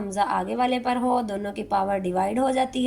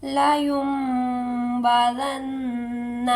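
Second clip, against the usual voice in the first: very slow held tilawat; Quran recitation; much slower than usual. Shown as a demonstration. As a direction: hold the nasal long. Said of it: "recitation demo in tajweed lesson". (آہستہ تلاوت)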